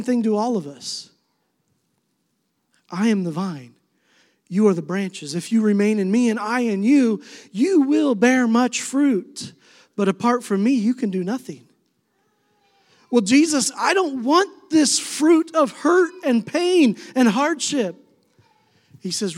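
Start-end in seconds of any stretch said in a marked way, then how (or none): 2.9-11.7 rising, slowing preacher's scripture read; preaching scripture. Acted. Pastor proclaims Jesus' words to the congregation, rising preaching. 13.1-18.0 a hint of a high, pleading complaint; mock pleading. Speaker voicing his own complaint to Jesus, mild mock plea.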